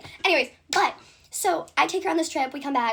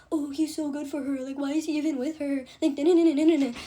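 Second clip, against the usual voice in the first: mocking gossip voice. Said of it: "trying to talk about, like".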